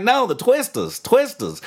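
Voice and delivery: high, insistent mock Australian; Australian accent. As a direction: correct them as an imitated Australian bloke. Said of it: "One of those dudes from Australia".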